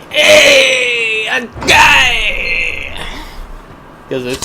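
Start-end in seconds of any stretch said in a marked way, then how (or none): 0.1-3.0 high, loud shouted Spanish villager. Retold or imitated. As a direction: yell like a crazed villager. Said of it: "random Spaniards going".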